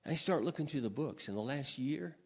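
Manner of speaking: ordinary talk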